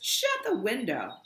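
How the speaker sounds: annoyed brother snapping; snapping order. Acted. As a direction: bark it at her to shut it. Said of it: "explodes Davis".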